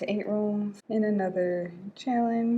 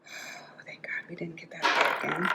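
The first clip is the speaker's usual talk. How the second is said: a whispered thank-God to yourself; hushed, relieved aside; 2 semitones lower than usual. Real, not acted. Relief on a dreaded roll.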